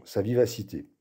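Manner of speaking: usual speaking voice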